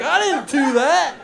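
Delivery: high, stretched-out whining denial; mock distressed protest. Eater denying what the chip bag says, mock distressed. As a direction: plead innocence in a hammed-up high voice.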